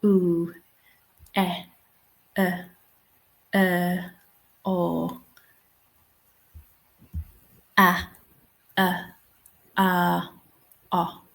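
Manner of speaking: isolated vowels, each on its own; short demonstration vowels with gaps between; vowel sounds. Shown as a demonstration. Sounding out chart vowels one by one.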